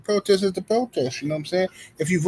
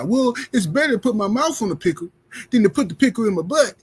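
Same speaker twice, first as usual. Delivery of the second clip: lightly voiced mock voter; mock voter excuse; 6 semitones higher than usual. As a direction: play a naive voter making excuses. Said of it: "you can justify".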